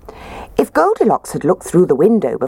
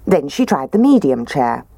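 usual speaking voice

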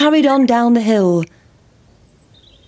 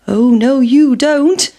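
higher and louder, defiant pancake; cheeky pancake, lightly raised; 4 semitones higher, 4 dB louder, slower than usual. Acted. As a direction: tease the wife as you escape. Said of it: "said the pancake".

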